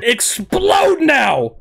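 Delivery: long hammed-up battle cry; drawn-out attack shout. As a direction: bellow it like a cartoon hero. Calling out the attack.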